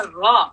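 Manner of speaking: रॉ said full and heavy. Shown as a demonstration. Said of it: "जबर होगा ... heavy पढ़ा जाएगा".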